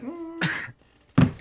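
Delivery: high, pointed mm; a wife's unimpressed hum; disapproving hum. Retold or imitated. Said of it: "Be like".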